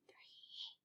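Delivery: breathy unvoiced number; whispered count. Shown as a demonstration. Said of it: "but in a whisper voice now".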